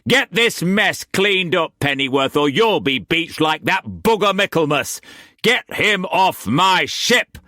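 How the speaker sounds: angry orders. A narrator's reading. Captain barking angry orders.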